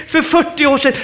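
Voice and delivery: lightly voiced protesting believer; congregant's excuse. Believer excusing themselves to the preacher, mildly protesting.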